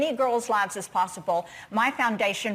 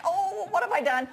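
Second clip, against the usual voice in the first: higher-pitched mock regret; retold dismay; 6 semitones higher than usual. Leader facing crying first year brownies, mock dismay. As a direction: lament it lightly, as a comic retelling.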